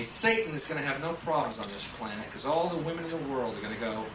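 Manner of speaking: ordinary talk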